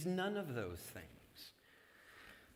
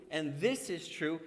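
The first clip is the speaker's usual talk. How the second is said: ordinary talk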